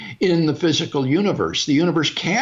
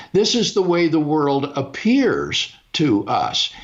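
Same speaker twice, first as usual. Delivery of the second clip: normal talking voice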